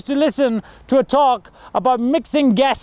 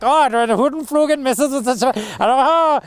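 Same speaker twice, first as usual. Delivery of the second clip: mock excited; 3 semitones higher than usual. A speaker mimicking an excited scientist's foreign accent, mock excited. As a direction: gush about the gases with a hint of a foreign accent. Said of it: "he gets all excited".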